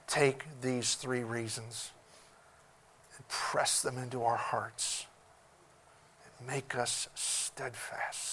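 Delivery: soft, hushed pastoral prayer; closing prayer. Acted. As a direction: pray quietly to close the sermon.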